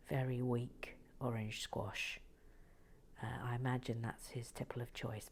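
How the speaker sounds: soft whispered aside; whispering. Real, not acted. Whispering about where to place the piece. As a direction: keep it hushed.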